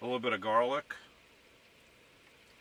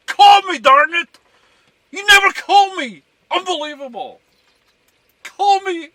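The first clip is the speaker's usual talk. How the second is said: very high, much louder falsetto squawk demanding a call; nagging caller; 18 dB louder than usual. Acted. Pretending to be someone ignored by family, shrieking in falsetto. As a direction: shriek it like an offended relative who never gets phoned.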